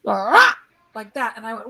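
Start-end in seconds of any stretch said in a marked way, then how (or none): 0.0-0.6 a short loud growling yell; mock growl. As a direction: imitate the spirit growling right in your ear. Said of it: "and it was like".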